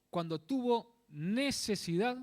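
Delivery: "necesidad" loud, slow and stretched; stress on necesidad. Real, not acted. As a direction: read slowly, pressing hard on "necesidad". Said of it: "Recalco esta palabra". (recalcar "necesidad" fuerte y alargada)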